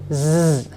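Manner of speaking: Z as a sustained voiced buzz; vibrant S. Shown as a demonstration. Teacher explains S in versehen, Z buzzed long. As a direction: make Z hum and vibrate. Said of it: "will be pronounced like".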